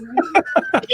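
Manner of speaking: high loud burst of real laughter. Real, not acted. Player cracking up at the table, loud laughter. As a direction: crack up loudly.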